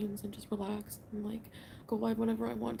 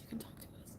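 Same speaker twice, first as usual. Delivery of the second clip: whisper; 11 dB quieter than usual. Retold or imitated. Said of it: "they've said numerous times".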